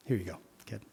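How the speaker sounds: missionary gently returning the jersey; softly. Retold or imitated. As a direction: hand it back gently. Quiet tender moment.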